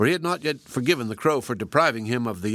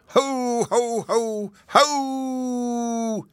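high howling hoots; howling shout; 12 semitones higher, much slower than usual. A narrator's reading. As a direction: howl it out, high and wild. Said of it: "he shouted".

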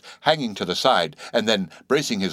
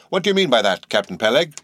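raised, higher indignant question; indignant challenge; 4 semitones higher, 4 dB louder than usual. Acted. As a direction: demand an answer, louder. Ishmael challenges captain.

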